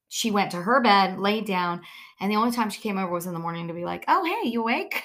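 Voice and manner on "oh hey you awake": high, light voice for the dog; lightly voiced curious dog; the dog asking. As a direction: voice the dog nosing at the door. Voicing the dog in the morning.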